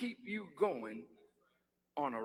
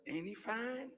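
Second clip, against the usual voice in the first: mocking a flirty sister; 4 semitones lower, 10 dB quieter, faster than usual. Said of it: "you talk like that sometimes".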